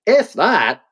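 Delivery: angry inner voice. Acted. Anger talking back to his own sadness, indignant. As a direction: play anger cutting in on sadness. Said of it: "an anger as well that comes in and just goes".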